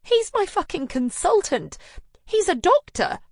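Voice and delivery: high, loud furious outburst; outraged friend. Acted. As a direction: spit out the betrayal. Anna outraged at Ben.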